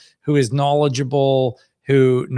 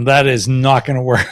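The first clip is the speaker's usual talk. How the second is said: laughing as the words come out; breaking into laughter; 4 dB louder than usual. Real, not acted. Host to off-camera helper whose fix is failing, laughing. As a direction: crack up on the last word. Host cracks up at tech failure.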